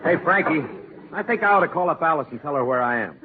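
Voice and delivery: normal talking voice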